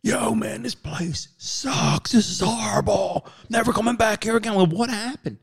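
high, slow mock whining; mud-covered guys griping. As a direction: gripe like soaked partiers. Muddy guys complaining.

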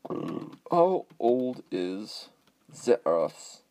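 mock German accent, slow and drawn out. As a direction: lightly ham up a German accent. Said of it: "I can do such a better German accent than that".

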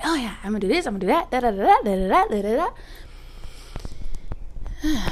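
loud, high, hyped-up mock boast; mock overeager self. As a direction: ham up a cocky pep talk.

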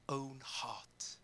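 sudden hushed whisper on the last words. Real, not acted. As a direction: drop to a breathy hush for emphasis. Scripture quote drops to whisper.

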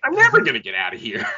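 put-on high whiny teenage Luke; Mark Hamill voice. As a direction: whine it like a sulky teen. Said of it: "I just love doing the Mark Hamill voice".

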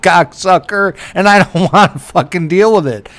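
forceful mock tirade; exasperated rant. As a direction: lay into the caller, drawing out the insult. Retells his angry refusal to salesman.

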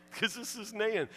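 normal talking voice

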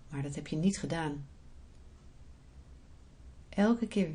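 soft, unhurried meditation voice; guided meditation. Acted. Leads a guided visualisation.

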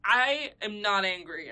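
loud, drawn-out mock-angry protest; angry denial. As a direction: imitate an angry man insisting, loud and slow. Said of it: "you're angry while saying that".

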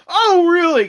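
high loud mock surprise; sarcastic oh really. Real, not acted. Retorting to the article's explanation, sarcastic mock surprise. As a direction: fake astonishment, dripping sarcasm.

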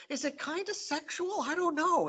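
high, wondering mock voice of his younger self; mock puzzled teen. A teen reader musing on the comic, high mock wonder. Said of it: "I'm like".

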